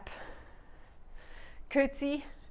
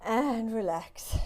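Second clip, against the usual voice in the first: ordinary talk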